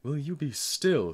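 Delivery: slow, loud, high irritated scolding; irritable. A narrator's reading. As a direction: grandmother snaps at the wailing child. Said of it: "said grandmother irritably".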